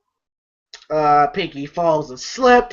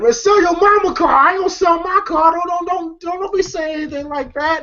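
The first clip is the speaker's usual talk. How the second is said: indignant retort; 12 semitones higher than usual. Retold or imitated. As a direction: protest high and offended, then trail off. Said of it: "Dave's like".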